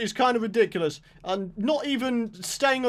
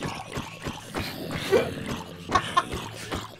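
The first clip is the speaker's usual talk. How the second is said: quiet laugh; 6 semitones higher, 6 dB quieter than usual. Real, not acted. Zombies jumping with joy.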